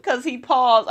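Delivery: ordinary talk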